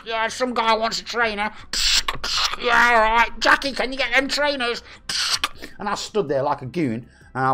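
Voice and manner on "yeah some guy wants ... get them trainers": higher, put-on radio voice of shop staff; mock walkie-talkie chatter.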